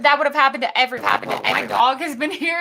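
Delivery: ordinary delivery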